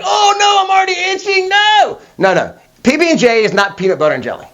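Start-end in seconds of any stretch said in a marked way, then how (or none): 0.0-2.0 high, shouted panic; panicked. Pretend. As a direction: cry out in alarm at an allergy. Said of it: "and then you're like".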